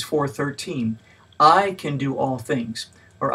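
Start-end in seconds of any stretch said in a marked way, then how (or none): 1.4-2.9 "I" punched, rest slow and even; stress on I. Shown as a demonstration. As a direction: lean hard on "I", then read on slowly. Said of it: "each time placing a different emphasis".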